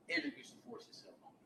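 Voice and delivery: high, soft, near-whispered admission; hushed confession. Retold or imitated. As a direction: let it slip out under the breath. Woman confides abuse to police.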